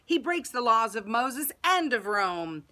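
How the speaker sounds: loud, emphatic accusations; crowd shouting. A narrator's reading. People accuse Jesus before the governor, shouting. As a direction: hurl charges from the crowd. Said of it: "the people shouted".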